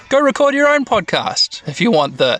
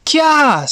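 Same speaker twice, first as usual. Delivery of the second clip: put-on high girlish squeal; startled shriek; 13 semitones higher, 4 dB louder than usual. Mimi hears the rumbling come closer, shrieking. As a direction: yelp it high in alarm.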